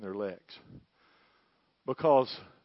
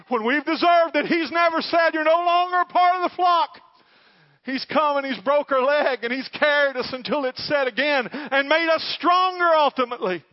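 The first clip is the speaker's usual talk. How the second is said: much higher, much louder impassioned preaching; preaching at full force; 12 semitones higher, 14 dB louder than usual. Real, not acted. Preacher at the climax of the sermon, loud and fervent. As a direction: shout the promise to the congregation.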